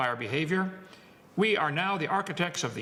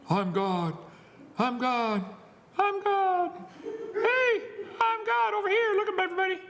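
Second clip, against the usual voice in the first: mocking self-proclaimed god. Preacher mocks a man shouting that he is God, building to a high hammed-up cry. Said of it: "Some people think".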